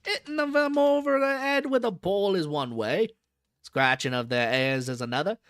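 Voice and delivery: put-on old London zookeeper in a Cockney accent. Zookeeper chatting to a visitor, Cockney accent. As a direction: drop the aitches, Cockney keeper at ease. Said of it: "This guy is such an accent".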